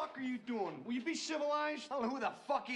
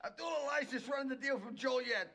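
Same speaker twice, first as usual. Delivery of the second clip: ordinary delivery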